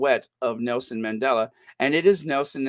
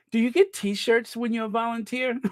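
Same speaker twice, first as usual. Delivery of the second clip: usual speaking voice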